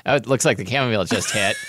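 ordinary talk

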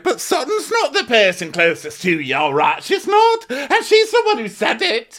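high indignant mock. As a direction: squeak it out in outrage. Mocking an indignant castmate.

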